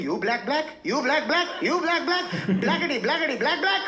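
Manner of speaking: put-on high, sing-song Indian accent chant, rising each time; a rising, sing-song Tamil villager accent chanted at a stranger; mock Tamil villager. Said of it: "he'd walk around in Harlem just going".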